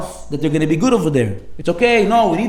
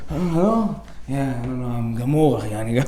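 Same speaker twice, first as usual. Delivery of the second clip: lower, softer, put-on exhausted tone; fake tired voice; 4 semitones lower, 6 dB quieter, slower than usual. Friend faking tiredness on the phone to the rabbi. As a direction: play up being worn out. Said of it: "doing all the things in the mirror".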